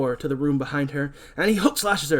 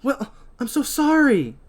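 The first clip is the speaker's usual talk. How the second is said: flustered apology; 9 semitones higher, 4 dB louder, slower than usual. Acted. Julie apologises to Will.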